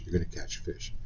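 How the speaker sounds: \"You're gonna catch a fish\" dropped to a near-whisper; soft unvoiced echo of the prediction; hushed repeat. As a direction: breathe the repeat, almost secret.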